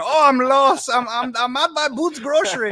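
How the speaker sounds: high, loud lost Cajun voice; a lost Cajun man calling OnStar for directions; Cajun accent. Retold or imitated. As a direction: play a lost Cajun man pleading for help. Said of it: "he's a Cajun all the way".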